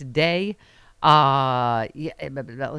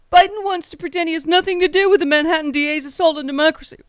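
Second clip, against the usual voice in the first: mocking high-pitched politician's voice; high, put-on caricature of a ranting politician; Trump; 11 semitones higher, 5 dB louder than usual. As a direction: read the post in a squeaky, much higher mock voice. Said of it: "real voice is actually that high pitched".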